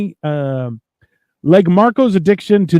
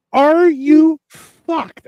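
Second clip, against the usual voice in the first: high, drawn-out disbelief; slow, high-pitched incredulous question; 8 semitones higher, much slower than usual. Retold or imitated. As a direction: stretch each word in mock astonishment. Acted reaction to outrageous stories.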